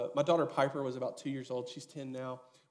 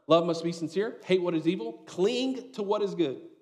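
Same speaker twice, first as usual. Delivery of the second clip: scripture reading; 4 semitones higher, 6 dB louder than usual. Acted.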